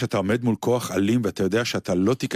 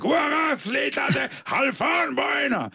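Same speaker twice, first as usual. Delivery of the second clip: much higher, hammed-up yelling of a name; comic shout. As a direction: bellow for Chalfon across the yard. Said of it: "שהוא צועק לו". (צעקה קומית וגבוהה)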